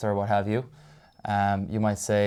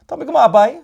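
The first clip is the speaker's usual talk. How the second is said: put-on loud, high, sing-song Cork accent; hammed-up Cork accent, pitched way up; 14 dB louder than usual. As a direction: belt it out in a playful Cork lilt. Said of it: "You have to do the accent".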